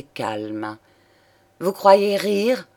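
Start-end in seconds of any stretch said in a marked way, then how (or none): 1.6-2.7 slow, raised, affronted question; indignant cry. A narrator's reading. Lover loses patience with her mockery, crying out. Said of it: "s'écria-t-il".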